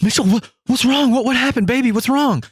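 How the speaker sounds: high-pitched, alarmed questioning; frightened girlfriend. Retold or imitated. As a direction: imitate a girlfriend startled awake and worried. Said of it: "It scares the shit out of her".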